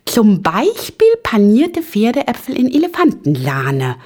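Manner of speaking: high, squeaky enthusiasm; swooping, zealous chirp; eager little Ping-Pong. A narrator's reading. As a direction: offer it keenly in a tiny high voice. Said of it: "rief Ping-Pong eifrig".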